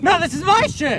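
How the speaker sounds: high, heated contradiction; indignant insistence. Acted. Rival host insisting the show is his, indignant. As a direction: claim the show as yours. Acted quarrel over the show.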